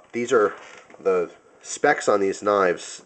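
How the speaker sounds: ordinary talk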